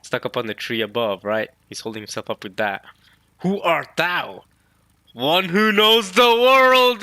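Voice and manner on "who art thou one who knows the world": slow, high, loud grand declaration; dramatic voices. Acted. Scopper answering Emu, slow and grand. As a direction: deliver it like an ancient, solemn oracle.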